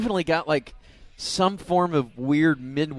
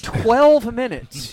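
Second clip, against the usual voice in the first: incredulous; 7 semitones higher, 9 dB louder, much slower than usual. Real, not acted. Host echoes the oddly specific number.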